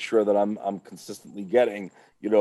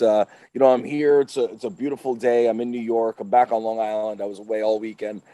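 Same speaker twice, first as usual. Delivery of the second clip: ordinary talk, nothing marked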